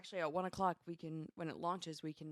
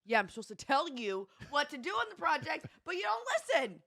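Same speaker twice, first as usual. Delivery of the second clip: high, loud exasperation with a laugh breaking in; exasperated protest; 9 semitones higher, 7 dB louder than usual. Real, not acted. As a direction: push back louder and higher, half laughing. Mock quarrel over who manages projects.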